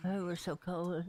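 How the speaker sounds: panting between words; winded, out of breath from the climb. Real, not acted. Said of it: "I'm just out of breath".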